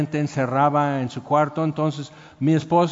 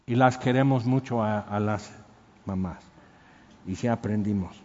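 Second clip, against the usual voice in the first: tender; 7 semitones lower, 5 dB quieter than usual. Real, not acted. Tender remark about mothers.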